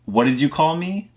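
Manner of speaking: high, rising challenge at normal volume; retold indignant yell. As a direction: imitate a biker demanding an answer. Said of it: "biker yell back at me".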